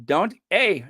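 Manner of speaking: ordinary delivery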